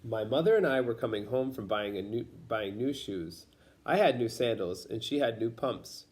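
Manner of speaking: ordinary delivery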